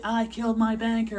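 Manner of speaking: high-pitched imitated yell; mock yelling. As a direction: cry it out high, as the voice in his head. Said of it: "the voice is just yelling".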